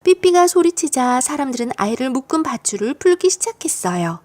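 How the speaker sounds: ordinary delivery, nothing marked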